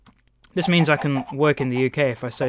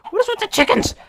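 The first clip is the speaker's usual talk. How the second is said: exasperated surprise. Real, not acted. Distracted by the chickens.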